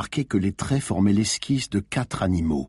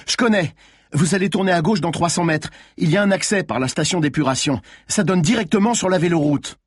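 high, urgent instructions; insistent directions; 7 semitones higher than usual. A narrator's reading. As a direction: push the driver on with pressing directions. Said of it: "insista Malines".

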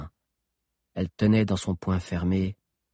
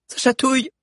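put-on very high taunting girl voice; mock the pain; defiant girl; 7 dB louder than usual. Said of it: "fit Sabi". (fillette provocante, très aiguë)

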